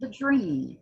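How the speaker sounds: rising, impassioned spoken-word reading; dramatic poetry reading with swelling intensity; poem recitation. Acted. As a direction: recite it like a rallying cry, building toward the end. Recites Hughes poem after introduction.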